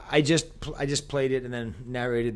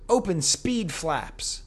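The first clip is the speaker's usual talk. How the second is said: quoting the ad; 7 semitones higher, much slower than usual. Retold or imitated. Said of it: "he goes".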